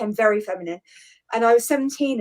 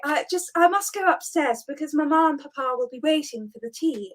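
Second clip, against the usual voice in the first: hammed-up posh English, higher pitch; really posh English; 4 semitones higher than usual. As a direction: become a mad caricature of an English person. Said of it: "I started going really posh".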